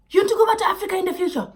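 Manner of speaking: quick, high mock of his question; imitating him. Mocking her date's question about going back to Africa. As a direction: parrot his pushy question back, fast. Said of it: "he was just like".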